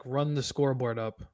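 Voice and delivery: ordinary talk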